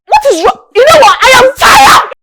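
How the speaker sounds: high, loud exasperated shouting; shouting, utterly fed up; furious outburst. Acted. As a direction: explode at the end of your patience. Acted quarrel, final outburst.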